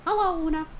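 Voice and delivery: high cartoon character voice greeting family; Mickey. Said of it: "family and stuff like that, it's like".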